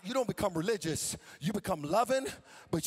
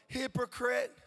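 normal talking voice

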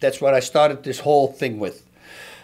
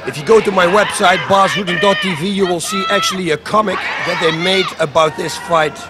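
high, loud play-by-play over the video; loud fight commentary; 4 semitones higher, 3 dB louder than usual. Real, not acted. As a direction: call each strike like a ringside commentator.

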